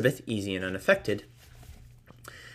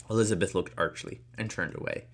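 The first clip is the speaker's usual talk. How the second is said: ordinary delivery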